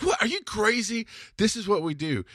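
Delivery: high-pitched playful disbelief; animated, not angry. Retold or imitated. Husband making wife laugh about charging family, animated disbelief. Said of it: "not in an angry way, but in the way I'm doing it now".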